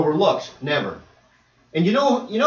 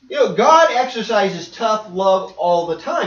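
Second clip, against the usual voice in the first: ordinary talk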